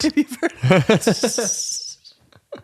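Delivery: slow, laughing repeat. Pretend. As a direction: laugh through the words. Laughing echo of line.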